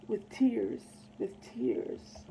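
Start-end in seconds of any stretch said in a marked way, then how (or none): 1.2-2.2 whispered, much lower and softer, dying away; fading whisper. Acted. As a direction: let the last "with tears" vanish into a whisper. Repeated line fades to whisper.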